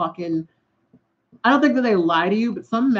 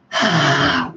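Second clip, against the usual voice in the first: heavy sigh; 5 semitones lower than usual. Real, not acted. Upset after a shitty text.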